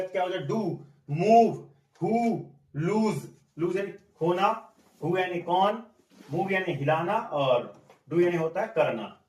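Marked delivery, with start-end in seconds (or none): 0.4-3.3 डू, मूव, हु, लूज with a long 'oo' vowel. Shown as a demonstration. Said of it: "ड और ओ है". (डू, मूव, हु, लूज में लंबी ऊ की आवाज़)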